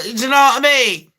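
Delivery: ordinary talk, nothing marked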